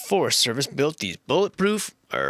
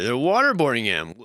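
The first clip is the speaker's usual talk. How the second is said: ordinary talk, nothing marked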